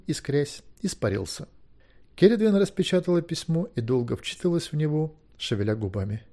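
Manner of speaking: usual speaking voice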